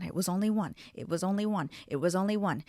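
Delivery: normal talking voice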